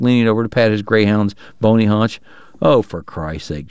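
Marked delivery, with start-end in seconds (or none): 2.6-3.7 lower, slow exasperated outburst; drawn-out groan of disgust; Chalky crying out. Acted. Chalky to the mayor about dogs, low exasperated cry. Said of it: "Chalky cried".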